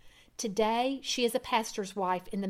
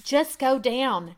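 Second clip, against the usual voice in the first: louder, slow firm push; urging; 3 semitones higher, 6 dB louder, slower than usual. Acted. Wife urging husband to go see their daughter, firm. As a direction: insist gently but firmly. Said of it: "she urged".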